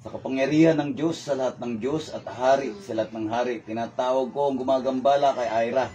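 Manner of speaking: steady, measured invocation; summoning prayer. Real, not acted. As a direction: call up the spirit in solemn prayer. Nagdarasal, tumatawag sa espiritu. (taimtim na dasal na pagtawag)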